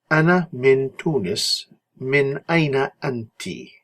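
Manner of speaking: slow, careful Ana min Tunis, min aina anti; teacher reading the dialogue slowly; slow model dialogue. Shown as a demonstration. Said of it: "teacher models dialogue line slowly".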